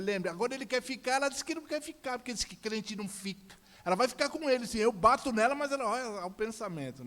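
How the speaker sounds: loud, high-pitched indignant tirade; worked-up ranting. Retold or imitated. Father ranting with wife.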